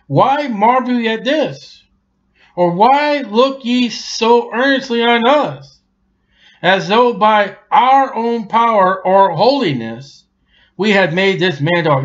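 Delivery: high, slow proclaimed scripture; preaching. Acted. Preacher voices Peter to the amazed crowd, high and slow. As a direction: lift it up and draw it out.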